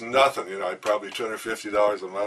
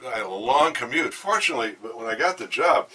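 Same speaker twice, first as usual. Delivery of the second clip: ordinary talk, nothing marked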